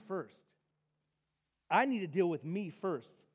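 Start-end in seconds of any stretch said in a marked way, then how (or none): none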